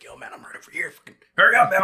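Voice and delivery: whispered hurry; urgent whisper. Retold or imitated. Hiding from stranger, whispering to stepdad. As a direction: hiss it, hiding in the dark. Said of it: "I'm like".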